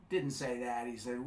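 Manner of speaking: usual speaking voice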